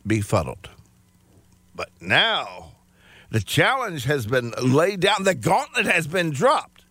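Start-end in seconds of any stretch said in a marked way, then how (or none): none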